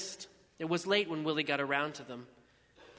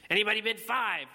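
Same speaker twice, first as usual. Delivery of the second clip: higher, louder impatient call; impatient auctioneer; 5 semitones higher, 6 dB louder than usual. A narrator's reading. Willie to a silent tent, impatient auctioneer's call. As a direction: prod a silent crowd for a bid. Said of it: "Willie sounded impatient".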